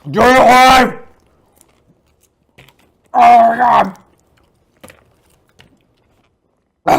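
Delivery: loud, high shout. Real, not acted. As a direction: yell it out. Big spoonful of sugar.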